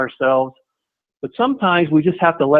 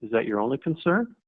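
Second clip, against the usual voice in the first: soft probing question; 9 dB quieter than usual. Shown as a demonstration. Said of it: "follow that up with".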